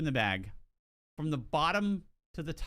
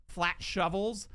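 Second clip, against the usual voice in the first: ordinary talk, nothing marked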